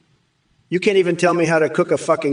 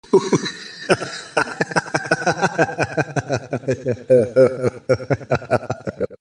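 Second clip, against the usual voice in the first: long laugh; 2 semitones lower than usual. Real, not acted. AI voice bursts into laughter.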